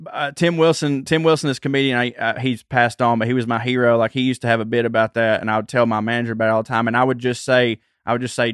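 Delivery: usual speaking voice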